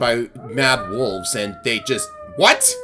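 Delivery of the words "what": high, loud startled yelp; very high-pitched, incredulous cry; shocked. Real, not acted. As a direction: blurt it out in sudden shock. Startled by an event in game.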